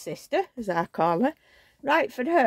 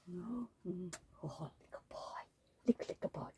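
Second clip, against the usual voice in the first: whispering; 17 dB quieter than usual. Real, not acted. Cooing over reborn baby doll.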